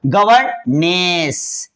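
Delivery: gently drawn-out गवर्नेस; very slow teaching word. Shown as a demonstration. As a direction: stretch गवर्नेस out slowly. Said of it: "N E S".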